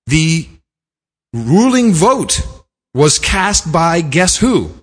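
high, drawn-out build-up with pauses; slow, showman-like suspense; suspenseful reveal. Real, not acted. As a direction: tease the big reveal, word by word.

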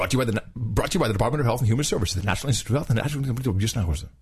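very rapid disclaimer gabble; words running together at top speed; fast voice. Acted. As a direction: rattle off the sponsor tag. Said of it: "you got the fast voice down".

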